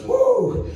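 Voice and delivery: high-pitched "woo"; excited whoop. Retold or imitated. Friend back from the club waking the comic, high whoop. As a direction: let out a high imitated whoop. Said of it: "he wakes me up, and he goes".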